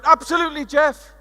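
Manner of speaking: shouted out. Retold or imitated. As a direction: shout it from the front row. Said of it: "And she shouted out".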